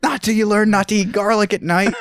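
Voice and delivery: high mock-scolding tease. To Bill, a high smug mock scolding. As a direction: lecture him smugly.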